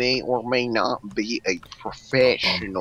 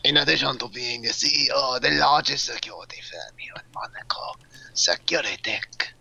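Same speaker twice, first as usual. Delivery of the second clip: whispering. Acted. Whispered briefing.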